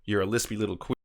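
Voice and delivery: ordinary talk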